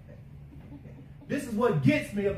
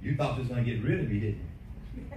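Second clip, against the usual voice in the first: lower, fast sneering demon voice; taunting demon; 9 semitones lower, faster than usual. Pretend. The demon taunting the believer at home, low fast and sneering. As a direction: gloat like a demon caught you. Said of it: "that demon began to manifest".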